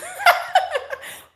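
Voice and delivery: very high burst of laughter; shrieking laugh. Real, not acted. Mocking his future-tense apology with a high laugh.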